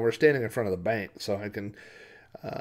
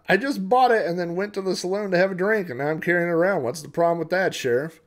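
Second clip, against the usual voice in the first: loud, higher-pitched indignant townsman; protesting cowboy; 8 semitones higher, 8 dB louder than usual. Acted. Rifle owner defends himself to the sheriff, indignant and loud. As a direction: argue back in a raised voice.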